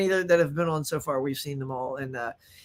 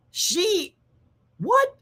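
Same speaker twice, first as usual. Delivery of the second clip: stunned disbelief; 13 semitones higher than usual. Real, not acted. Reacting to actress's age.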